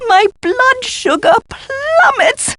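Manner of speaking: very high, drawn-out wail; melodramatic complaint. Acted. Hungry professor demanding food, melodramatic high wail. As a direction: wail it slowly, as if starving.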